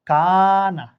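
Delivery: "কানা" with its first vowel held long and level; drawn-out vowel. Shown as a demonstration. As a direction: stretch the first syllable of "কানা", then a short ending. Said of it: "এক আলিফ". ("কানা"-র প্রথম স্বর এক আলিফ টেনে)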